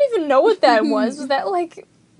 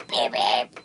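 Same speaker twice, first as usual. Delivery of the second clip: deep low-pitched beeps; 5 dB quieter than usual. Shown as a demonstration. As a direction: drop way down for the beeps. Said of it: "Or it could be like".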